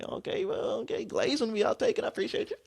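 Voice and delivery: very high breathy giggly reply; a bashful squeaky thank-you; flustered falsetto. Retold or imitated. Answering the teacher's compliment in a flustered high voice. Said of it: "I was like".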